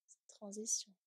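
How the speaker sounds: ordinary talk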